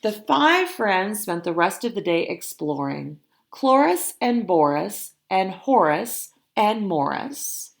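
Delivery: ordinary delivery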